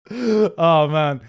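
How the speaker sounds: ordinary delivery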